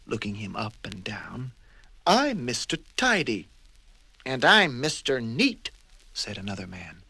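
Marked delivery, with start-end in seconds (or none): 2.0-3.5 higher, prim introduction; proper little man presenting himself; prim character voice; Mister Tidy voice. Acted. 4.2-5.7 louder, pleased introduction; chiming in proudly; lightly voiced neat man; Mister Neat voice.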